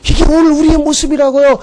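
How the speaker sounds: usual speaking voice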